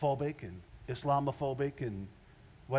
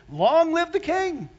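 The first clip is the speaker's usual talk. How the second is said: high, loud, slow proclamation; loud hailing of a king; rousing shout; 12 semitones higher, 11 dB louder, slower than usual. Acted. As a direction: proclaim it like a cheering nation. Crowd shouting for new king.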